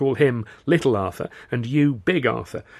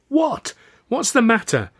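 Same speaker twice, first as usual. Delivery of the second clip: very high, louder bewildered question; baffled alarm; 13 semitones higher, 5 dB louder, slower than usual. A narrator's reading. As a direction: call after her, completely baffled. Calling after her as she leaves.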